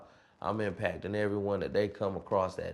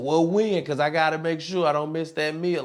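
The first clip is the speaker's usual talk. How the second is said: higher, louder mimicked pestering; imitated insistent pupil; 7 semitones higher, 6 dB louder than usual. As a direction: lightly act a kid pressing for an answer. Pupil presses coach for an answer.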